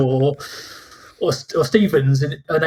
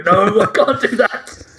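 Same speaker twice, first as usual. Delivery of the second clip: laughing refusal; 6 semitones higher, 4 dB louder than usual. Retold or imitated. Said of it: "You go".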